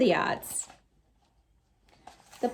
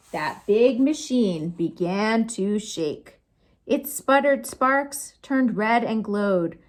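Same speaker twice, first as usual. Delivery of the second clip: usual speaking voice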